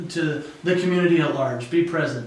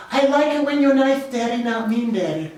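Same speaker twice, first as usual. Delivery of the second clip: put-on higher, small child voice; imitating his little son; 6 semitones higher than usual. Dad voicing his son in the car, childlike and higher. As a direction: play the three-year-old, innocently honest. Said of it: "And he goes".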